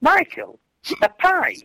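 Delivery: high, disbelieving; incredulous question. Retold or imitated. Asking Michael about his pipe, retold in a high incredulous voice. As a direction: call out to him in amused disbelief at the pipe. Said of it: "I just went up to him and says".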